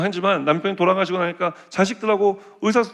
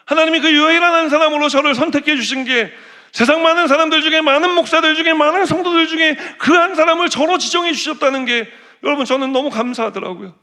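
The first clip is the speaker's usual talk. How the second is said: higher and louder, building to a peak; swelling with excited gratitude; rising excitement; 8 semitones higher, 4 dB louder than usual. Real, not acted. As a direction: preach it up with mounting joy. Preacher rising to a climax.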